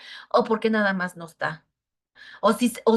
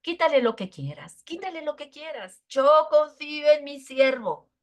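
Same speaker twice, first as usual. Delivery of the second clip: voice of God; 6 semitones higher than usual. Retold or imitated. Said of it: "Y le dice el Señor".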